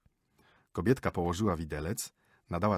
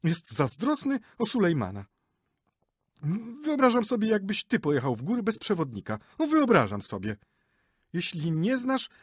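higher, huffy scolding; lecturing wife; 9 semitones higher, 4 dB louder than usual. Acted. Wife lectures her husband about guides, high and scolding. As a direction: put him in his place.

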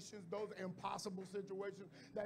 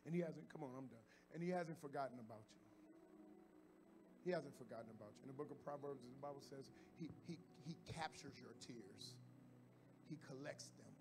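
soft, hushed sermon voice; quiet preaching; 4 semitones lower, 6 dB quieter than usual. Acted. Pastor gently reassuring the church, softly. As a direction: bring it down low and intimate.

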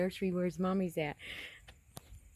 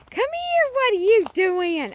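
high, loud call, then louder and slower question; calling the puppy; 10 semitones higher, 9 dB louder, slower than usual. Real, not acted. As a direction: call out to the dog, half scolding.

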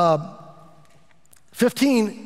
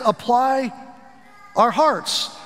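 drawn-out emphasis; 6 semitones higher, much slower than usual. Shown as a demonstration.

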